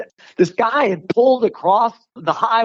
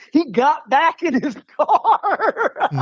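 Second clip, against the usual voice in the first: slow and higher, laughing through the words; 7 semitones higher, slower than usual. Real, not acted. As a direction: giggle out the ending in relief.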